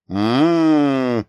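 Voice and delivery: slow, drawn-out admiring hum; appreciative madam. A narrator's reading. Madam inspects young man under the lamp, appreciative. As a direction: draw it out while looking him over. Madam appraises man's face, purring.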